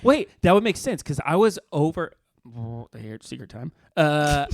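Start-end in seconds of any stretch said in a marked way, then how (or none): 3.0-3.7 dropped to a hushed confiding tone; secret voice. Real, not acted. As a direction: lean in and share a secret quietly.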